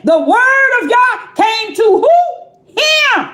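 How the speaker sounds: building shout. Acted. Preacher hammering the point home, rising shout. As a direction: drive it up to a ringing shout.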